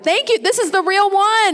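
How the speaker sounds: high-pitched, genuinely delighted; thrilled amazement. Real, not acted. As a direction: marvel at it, voice soaring.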